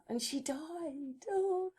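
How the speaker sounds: very soft, drawn-out mock lament; mock sorrow. Co-host laments the film queen's death, in mock sorrow. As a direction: let it sink away slowly in pretend pity.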